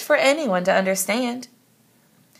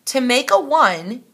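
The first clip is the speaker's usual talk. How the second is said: higher, emphatic exclaim; 5 semitones higher, 4 dB louder than usual. A narrator's reading. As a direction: announce it like a proud inventor. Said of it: "Count Infinity exclaimed".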